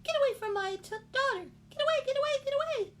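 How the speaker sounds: very high and loud; high squawking mama bird yelling; fierce mother bird. Acted. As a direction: drive the cat off her baby. Mama bird fights off cat.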